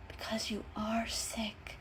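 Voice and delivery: slow whispered words. A narrator's reading. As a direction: whisper it to her under the bed.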